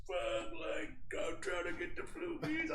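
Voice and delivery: high, whispered strained sob; imitating sobbing trucker. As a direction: squeeze it out through mock tears. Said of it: "he's, like, crying".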